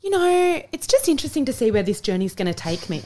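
high-pitched musing opening, easing down to normal; imitating dad's positivity. Daughter imitates dad on his cancer journey, lightly upbeat musing. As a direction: start high and airy, then settle into easy optimism. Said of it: "he was always just like".

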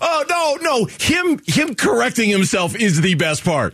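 usual speaking voice

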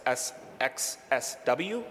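ordinary talk

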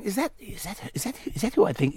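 excited undertone, softly acted; hushed onlookers. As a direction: imitate a queue furtively asking each other. Onlookers murmuring about spotting a star.

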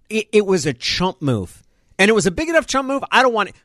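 rising, fast angry rant; heated outburst. Real, not acted. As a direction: spit it out, fed up. Heated rant, pitch climbs.

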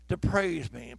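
ordinary talk, nothing marked

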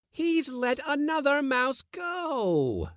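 very high, slow outraged wail; bawling. A narrator's reading. Uncle bawling at the failed kitten, very high. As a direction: bawl in disgust. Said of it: "bawled Pyotr Demyanich".

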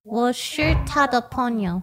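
我是他的朋友 said slowly; slow careful Mandarin. Shown as a demonstration. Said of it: "how do you say".

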